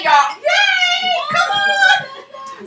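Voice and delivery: high, hyped welcome call; bright rising hype for the arriving guest; excited cheer. Real, not acted. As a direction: whoop them in like a crowd warmer.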